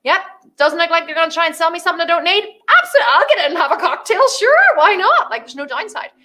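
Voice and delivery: high, quick chatter of curious people; mock crowd talking themselves into it; impressed onlookers. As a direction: sound won over and eager. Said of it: "people are like".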